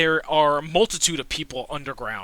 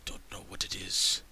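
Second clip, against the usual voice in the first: hushed conspirator; 6 semitones lower than usual. Acted. Game master voices a grim conspirator warning the party, in a low whisper. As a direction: lean in and share a dark secret.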